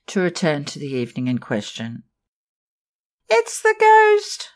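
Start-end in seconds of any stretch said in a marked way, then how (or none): 3.3-4.5 put-on high, loud terrified cry of a young girl; frightened cry. Young dancer cries out to the dressing room, terrified. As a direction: cry out in sudden terror. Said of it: "little Germaine had cried".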